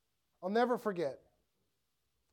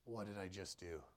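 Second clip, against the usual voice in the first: very low, quiet self-questioning; hushed regret; 12 semitones lower, 17 dB quieter than usual. Retold or imitated. Preacher voicing regret after saying too much, hushed. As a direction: sink the voice right down, asking yourself.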